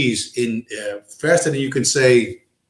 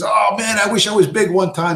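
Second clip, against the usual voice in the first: higher, half-shouted cop line; cop shouting; 8 semitones higher than usual. Retold or imitated. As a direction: blurt it out like a startled cop. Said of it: "He says".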